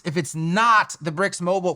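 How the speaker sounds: "not" leaned on hard mid-phrase; stress on NOT. Shown as a demonstration. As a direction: punch the word "not" inside the phrase. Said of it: "why am I stressing that word".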